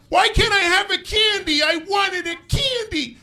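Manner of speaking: put-on high, loud, screeching child tantrum; shrill little-kid demand, hammed-up; bratty whining kid. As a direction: play a kid throwing a candy tantrum. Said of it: "Your kid's being this shit".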